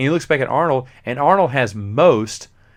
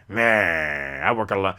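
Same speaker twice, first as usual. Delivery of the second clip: lower, dismissive loner grunt; grizzled veteran; 4 semitones lower, slower than usual. Retold or imitated. Grizzled veteran brushing off the rookie, low and dismissive. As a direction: shrug it off like a jaded old cop. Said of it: "grizzled veteran goes".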